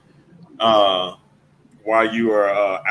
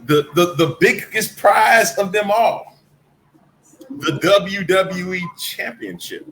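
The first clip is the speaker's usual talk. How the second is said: slow, higher ring announcer call; 4 semitones higher, slower than usual. Acted. Answering his co-host, slow announcer build-up. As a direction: build it up like a big title reveal.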